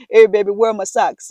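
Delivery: a husband calling out from another room; lightly voiced husband asking for soap; loud mock husband holler. Voicing husband interrupting.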